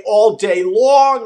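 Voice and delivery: slow, exaggerated Long Island vowels; Long Island accent. Shown as a demonstration. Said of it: "like a true Long Islander".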